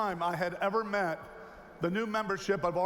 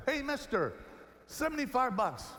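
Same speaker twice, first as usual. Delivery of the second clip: put-on higher, eager kid's sales pitch; a mock street vendor, raised pitch; street kid hawking; 6 semitones higher than usual. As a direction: call out to the tourist, pitch up. Kid calls out again.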